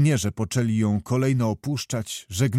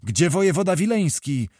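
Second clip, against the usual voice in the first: mild shout, pitched higher; shouted demand; 5 semitones higher than usual. A narrator's reading. Small knight bursting from the crowd, shouting his question. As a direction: call out the question in a raised, higher voice. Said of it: "i krzyknął".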